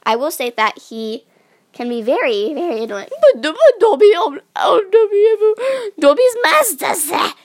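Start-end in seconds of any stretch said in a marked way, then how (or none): 3.2-7.3 high crying elf voice, much louder; house-elf voice. Acted. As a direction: wail it like a frantic little elf. Imitating Dobby the house-elf.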